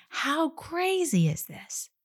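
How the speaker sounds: very high, slow disbelief; incredulous. Retold or imitated. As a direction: sound amazed anyone would do this. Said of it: "in some tone of voice saying".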